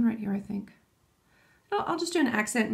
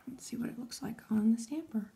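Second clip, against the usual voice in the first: soft concentrated whisper; 6 dB quieter than usual. Real, not acted. Vlogger whispers to viewers while stamping. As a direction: whisper while you focus on the nail.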